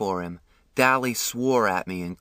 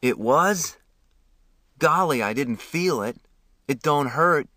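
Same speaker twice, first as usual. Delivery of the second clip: surprised, a little higher; 4 semitones higher than usual. Acted. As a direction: sound caught off guard. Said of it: "I was surprised".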